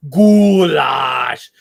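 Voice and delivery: GOULASH bellowed long and loud; drawn-out goulash shout. Retold or imitated. Telling how they greeted the goulash at a regular place, long loud shout. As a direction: belt out a stretched, relishing GOULASH. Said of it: "she just loves the way I say it".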